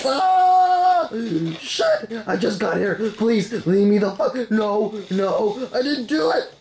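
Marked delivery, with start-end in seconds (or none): 0.1-2.1 loud high scream then curse; frightened scream. Real, not acted. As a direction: scream as you're struck. Hit by monsters.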